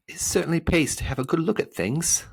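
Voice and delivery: high, lively voice for Milo; boy character voice. A narrator's reading. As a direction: observe it as a young hero.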